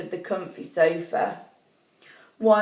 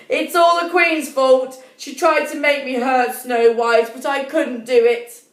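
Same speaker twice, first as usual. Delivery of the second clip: louder, higher, insistent huntsman; the huntsman blames the queen, pushing hard; upset huntsman; 3 semitones higher, 5 dB louder than usual. Acted. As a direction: huntsman pleading his case, raised and urgent. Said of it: "the huntsman stated".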